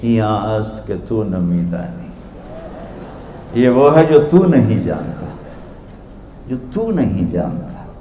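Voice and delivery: softly, gently innocent; a dervish softly answering the proud scholar. Retold or imitated. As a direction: say it with quiet, knowing innocence. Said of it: "बड़ी मासूमियत से बोले".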